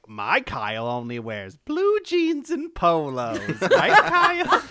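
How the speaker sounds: hammed-up mom, rising high and loud; mock mom crowing over her boy; imagined proud mom. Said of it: "your mom's like".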